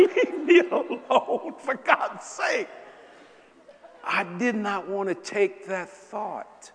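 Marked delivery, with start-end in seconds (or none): 0.0-2.7 slow, laughing protest; laughing plea. Retold or imitated. Brushing off his wife while laughing, slow laughing protest. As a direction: drag it out through laughter. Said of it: "I said".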